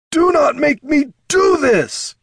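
high, loud, slow desperate cry; cried out. A narrator's reading. Chieftain crying out to his sister, loud and high. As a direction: beg her to stop, crying out. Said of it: "Darek cried".

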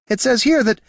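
high, pleased find; eager high voice. A narrator's reading. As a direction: chirp it out, delighted. Niln character voice.

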